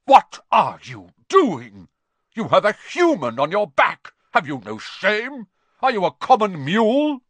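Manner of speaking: angry centaur, high and loud; indignant roar, pitch climbing; thundering outrage. A narrator's reading. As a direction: thunder a furious scolding. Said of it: "Bane thundered".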